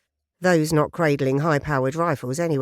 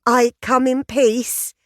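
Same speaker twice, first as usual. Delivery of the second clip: high, loud, goofy greeting; higher and louder, all goofy cheer; goofy hello; 7 semitones higher, 5 dB louder, slower than usual. Acted. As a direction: beam it out like a harmless tourist. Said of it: "the goofy smile still in place".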